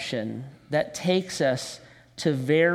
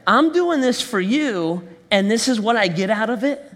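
indignant complaint; 5 semitones higher, 4 dB louder than usual. Retold or imitated. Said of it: "That's our mindset".

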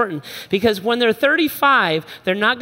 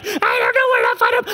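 put-on whining kid. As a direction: whine it helplessly.

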